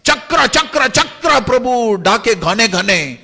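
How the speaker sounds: loud, high, emphatic call; thundering summons. Acted. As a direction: summon the weapon like a cloud roaring. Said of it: "घने आवाज में". (गरजती हुई पुकार)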